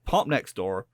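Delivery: a light rustic West Country farmer voice; West Country accent. Retold or imitated. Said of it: "I went proper West Country".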